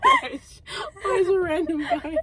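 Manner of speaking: high, laughter-choked question; helpless laughter. Real, not acted. Reader questioning own story's random guy, helpless laughter. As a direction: squeak out the question through giggles.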